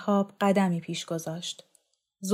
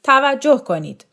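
loud call for attention, pitched up; shout; 6 semitones higher, 10 dB louder than usual. A narrator's reading. Young director calling his actors together, shouting. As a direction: clap and shout to gather the cast. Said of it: "فریاد کشید".

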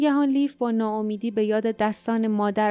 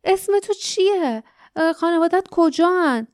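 high, raised calling voice; frightened shout; 6 semitones higher, 5 dB louder than usual. A narrator's reading. Lif calls after the departing girl, afraid. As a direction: call after someone in a wave of fear. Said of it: "لیف با موجی از ترس به دنبال او فریاد زد".

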